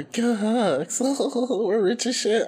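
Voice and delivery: high, laughing mock of Sierra gushing. Podcast host mocks Sierra's post, high and laughing. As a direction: squeal it like a giddy rich girlfriend. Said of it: "Sierra don't make it no better".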